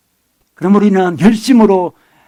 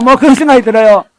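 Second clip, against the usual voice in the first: ordinary delivery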